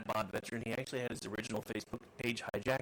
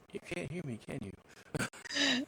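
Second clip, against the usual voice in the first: mock puzzled question; 7 semitones higher, much slower than usual. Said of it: "talking away going".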